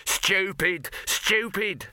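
high, exasperated shout; high-pitched angry outburst. A narrator's reading. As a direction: shout it twice in outrage. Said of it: "shouted Dibbler".

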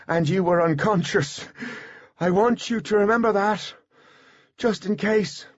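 high-pitched earnest calling voice; Artemis, urgent farewell. A narrator's reading. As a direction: insist on it as last words. Voices Artemis's parting words to Butler.

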